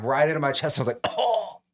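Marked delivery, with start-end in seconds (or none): 1.1-1.6 high, pained gasp of "oh"; winded "oh". Retold or imitated. Kid just whacked by mom, mock pained gasp. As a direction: imitate taking a blow to the chest. Reacting to being hit in chest.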